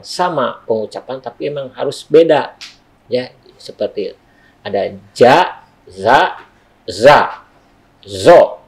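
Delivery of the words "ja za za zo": "ja, za, za, zo" one by one, each punched; contrasted letter sounds. Shown as a demonstration. Teacher showing letters that must differ, separate stressed "ja, za, za, zo". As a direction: keep each of "ja, za, za, zo" distinct and emphatic. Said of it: "itu harus dibedakan".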